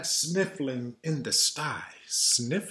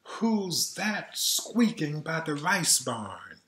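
sing-song read-aloud lilt; reading to children; 3 semitones higher than usual. A narrator's reading. Reading a lullaby book to kids, sing-song. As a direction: read it gently to a little one.

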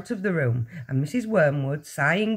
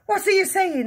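high, loud, alarmed cry; 5 dB louder than usual. A narrator's reading. Husband hears about the disinfectant, crying out. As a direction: cry out in disbelief. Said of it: "the husband cried".

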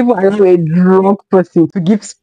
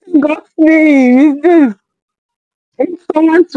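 slow, high, stunned disbelief; incredulous; 9 semitones higher, slower than usual. Real, not acted. Vlogger stunned by the drunk speech, slow incredulous. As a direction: drag the words out in shock.

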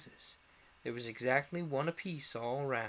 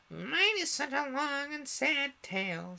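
very high, slow mouse voice; sighing mouse; 13 semitones higher, 5 dB louder, slower than usual. A narrator's reading. Mouse turning to Alice with its tale, high and doleful. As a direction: lament it slowly in a high mouse squeak. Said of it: "turning to Alice and sighing".

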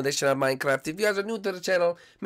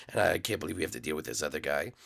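much lower, softer, fast aside; low grumbling ghost; 11 semitones lower, 8 dB quieter, faster than usual. Acted. Ghost leader grumbling about the new ghost, low and soft. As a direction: grumble it under your breath.

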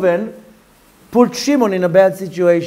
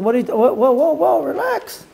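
taken aback; 7 semitones higher than usual. Acted. Levi fending off screaming Shimon, mildly startled. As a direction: back off with startled whoas, climbing in pitch.

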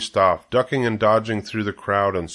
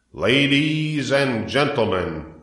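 slow, drawn-out, higher-pitched announcement; loudspeaker announcer; 4 semitones higher, slower than usual. A narrator's reading. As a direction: hail the crowd with ceremony. Loudspeaker addressing the fair crowd.

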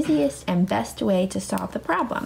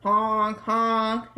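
truck horn honk. Pretend. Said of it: "the truck driver cheer".

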